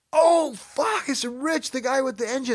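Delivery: high-pitched, startled realization; mock startled gasp of recognition; shocked realization. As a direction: suddenly realize who it is. Sudden realization at the door.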